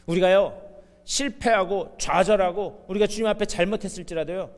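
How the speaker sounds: ordinary talk, nothing marked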